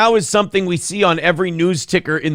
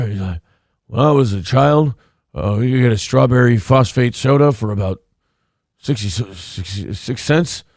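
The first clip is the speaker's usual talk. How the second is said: hushed, rambling old-man impression; old man whisper; 5 semitones lower than usual. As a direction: murmur it like an old man reminiscing. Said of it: "old man whisper".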